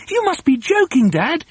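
put-on very high child's protest; incredulous cry. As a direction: protest to Dad in squeaky disbelief. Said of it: "they cried".

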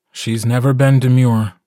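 plain reading voice

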